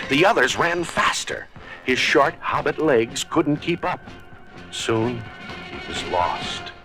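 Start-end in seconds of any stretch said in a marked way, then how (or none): none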